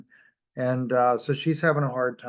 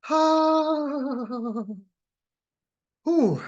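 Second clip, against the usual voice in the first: burning-mouth exhale; 11 semitones higher than usual. Real, not acted. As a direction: blow out the heat of the spice. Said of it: "My mouth is on fire".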